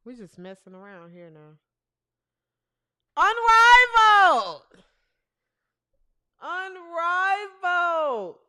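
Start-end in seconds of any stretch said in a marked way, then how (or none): 3.1-4.6 loud, higher, drawn-out announcer call; announcer shout. Real, not acted. Host opening the Unrivaled segment, loud announcer shout. 6.4-8.4 slow, stretched league name without shouting; drawn-out announcer call. Real, not acted. Host introducing the Unrivaled rosters, drawn-out announcer call.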